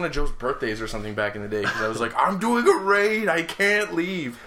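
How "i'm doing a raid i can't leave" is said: high, loud, drawn-out excuse; mock protest. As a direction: imitate yourself pleading, stretching the words out. Acting out own old excuse.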